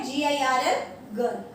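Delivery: ordinary delivery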